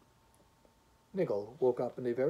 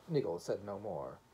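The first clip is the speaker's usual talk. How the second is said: ordinary delivery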